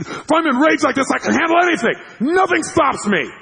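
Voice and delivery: high, loud shouted bravado; imitated rage. Raging buddy explaining himself, fierce shout. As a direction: roar it like an unstoppable raging man. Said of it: "when you get into rage like that".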